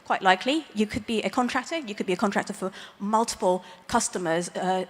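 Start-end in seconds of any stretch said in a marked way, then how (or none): none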